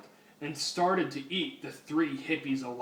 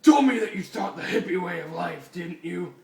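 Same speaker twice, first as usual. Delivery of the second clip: angry scolding; 3 semitones higher, 8 dB louder than usual. Acted. Batman berates hippie loudly.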